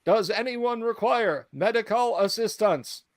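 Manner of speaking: hollow, high metallic robot voice; metallic voice filter. Acted. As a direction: speak like a hollow-sounding machine. Robot repeats question.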